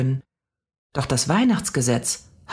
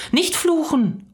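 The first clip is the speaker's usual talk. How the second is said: put-on much higher blurted child; slower than usual. A narrator's reading. Mia blurting at the cursing old lady, much higher. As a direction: burst out with it. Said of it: "platzte es aus Mia heraus".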